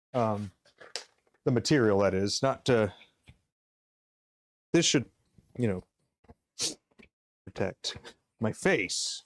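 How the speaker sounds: muffled behind a respirator mask; through a respirator. Real, not acted. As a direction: talk through a face mask and shield. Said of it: "I gotta get my respirator on".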